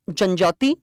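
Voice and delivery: ordinary talk, nothing marked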